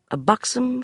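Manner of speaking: usual speaking voice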